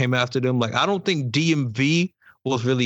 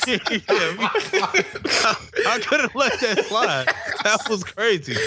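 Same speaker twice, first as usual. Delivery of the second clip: higher-pitched, laughing through the words; 8 semitones higher than usual. Real, not acted. Reacting to a friend's reckless outburst, laughing. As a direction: say it while cracking up. Cracking up over the reckless remark.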